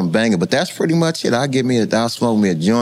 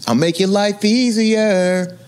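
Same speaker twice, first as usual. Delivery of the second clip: voicing the gun; 10 semitones higher, slower than usual. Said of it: "he said".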